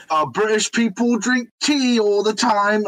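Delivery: high mocking voice; mock American. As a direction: parody a clueless American. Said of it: "they're like".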